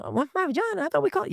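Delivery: put-on very high, puzzled girl's question; lightly voiced girl, unsure; confused little girl. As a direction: ask like a bewildered daughter. Daughter confused by word.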